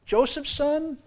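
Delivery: high, wondering voice of the crowd; high, lightly voiced astonished townsfolk; mock astonished crowd. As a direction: play the amazed townsfolk. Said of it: "and they said".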